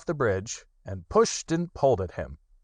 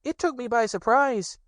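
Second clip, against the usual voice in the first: high, timid piglet voice; high little nervous guess. Acted. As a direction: Piglet afraid to say it. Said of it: "said Piglet nervously".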